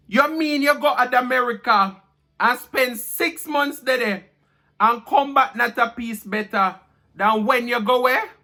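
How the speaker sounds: high, lively Jamaican patois reading. Acted.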